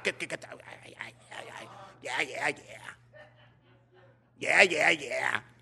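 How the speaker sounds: unvoiced mocking ay ay ay then a bark of yeah; hushed mocking grumble rising to a yell; mock jealous grumbling.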